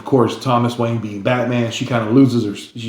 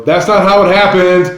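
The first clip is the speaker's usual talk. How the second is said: loud, raised movie-theater heckle; yelling at the screen; 8 semitones higher, 6 dB louder than usual. Retold or imitated. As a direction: call out your objection to the whole theater. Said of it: "I'm the one there yelling that".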